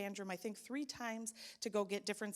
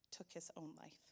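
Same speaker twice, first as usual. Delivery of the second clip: usual speaking voice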